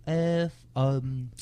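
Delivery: bad mock Turkish accent, slow and high. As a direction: fake a Turkish accent badly. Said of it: "I don't know how to do a Turkish voice".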